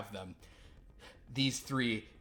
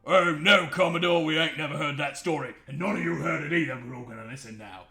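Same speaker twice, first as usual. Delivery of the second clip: loud, overeager crewman; eager sailor talking over himself; 4 semitones higher, 8 dB louder than usual. Acted. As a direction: crewman pushing everyone to listen. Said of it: "this guy's like".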